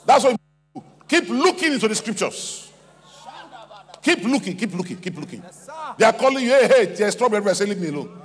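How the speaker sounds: high, driving exhortation; loud, urgent pulpit delivery; full-force preaching. Real, not acted.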